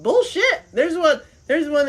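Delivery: high, loud exclaimed bullshit. Real, not acted. Scoffing at people who say it can't exist, loud. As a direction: shout it down, full of disbelief. Calling out doubters.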